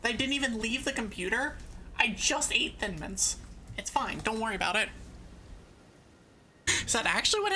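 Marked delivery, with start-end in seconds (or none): none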